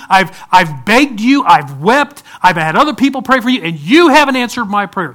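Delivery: exasperated believer railing; frustrated outburst. Retold or imitated. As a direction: vent years of unanswered prayer. Voicing frustrated believer at God.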